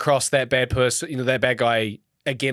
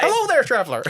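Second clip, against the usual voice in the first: higher, welcoming shopkeeper voice; shopkeeper greeting; 12 semitones higher than usual. Acted. A game shopkeeper greeting a traveller in a high voice.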